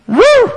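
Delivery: very high, loud, shouted dog yelp; dog's yelp. Retold or imitated. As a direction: let out a big yelp like a proud dog. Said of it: "would go".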